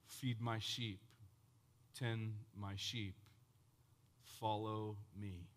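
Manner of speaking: soft, slow, low words of Jesus; hushed orders, each phrase set apart; solemn, quiet command. Acted. Jesus gives Peter his walking orders, solemn and quiet.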